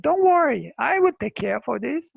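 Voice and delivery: high, loud don't worry; eager reassurance. Retold or imitated. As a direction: jump in keen to take the job on. Woman boasting to the leaders.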